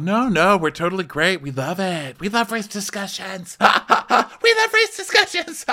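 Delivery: high, giggly mock enthusiasm; gushing reassurance. Co-stars assuring Garcelle all is fine, gushing. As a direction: gush it, climbing to a squeal with laughs. Co-hosts' gushing denial, acted.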